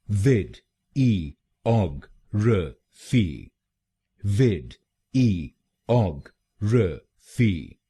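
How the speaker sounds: विड, ई, ऑग, र, फी spaced out, each syllable separate and slow. Shown as a demonstration. Teacher slowly splitting the word for learners: विड, ई, ऑग, र, फी. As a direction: break it apart: विड ई ऑग र फी, slowly.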